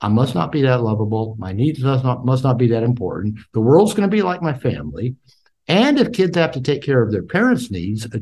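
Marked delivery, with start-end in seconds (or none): none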